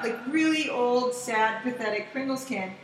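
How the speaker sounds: usual speaking voice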